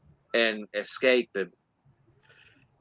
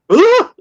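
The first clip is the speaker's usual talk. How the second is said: short high, loud "ah!"; sudden mock yell, pitched way up; startled yelp; 14 dB louder than usual. Retold startled creature or man's cry.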